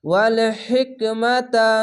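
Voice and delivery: ওয়াল হিকমাতা with a breathy ha pushing out air; airy ha in hikma. Shown as a demonstration. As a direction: push air through the ha of হিকমাতা. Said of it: "এরকমভাবে পড়তে হবে".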